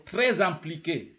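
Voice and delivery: TRÈS joined to IMPLIQUÉ with a z sound; liaison. Shown as a demonstration. Teacher shows learners the join in TRÈS IMPLIQUÉ, liaison. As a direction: run TRÈS into IMPLIQUÉ, linking z. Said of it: "you have to join".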